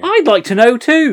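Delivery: high and loud in mock exasperation; exasperated. Real, not acted. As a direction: throw up your hands at the nonsense. Mock exasperation about plot.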